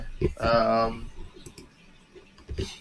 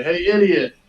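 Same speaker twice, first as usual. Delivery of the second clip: taunting shout; 7 semitones higher, 6 dB louder than usual. Acted. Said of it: "I'll say".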